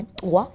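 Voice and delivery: طوا with the tongue pressed to the palate and released fast. Shown as a demonstration. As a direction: tap the palate quickly for طوا. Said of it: "زبان جو ہے آپ کے دانت کا جو اوپر والا تالو ہے".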